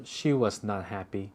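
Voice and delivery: ordinary talk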